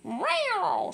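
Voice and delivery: high, mock cat meow. Kitty boy answers mama with a high meow. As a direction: play the kitty meowing. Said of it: "she says".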